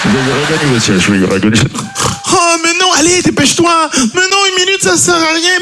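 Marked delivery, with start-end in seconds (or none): 2.3-5.6 very high whining falsetto; high-pitched, frustrated nagging; protesting wife. Acted. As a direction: wail the protest as a caricatured wife. Wife refuses seat with minute left.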